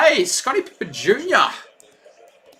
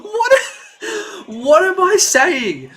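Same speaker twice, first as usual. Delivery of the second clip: laughing; 12 semitones higher, slower than usual. Real, not acted. Laughs at his own mix-up.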